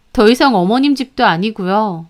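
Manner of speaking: ordinary delivery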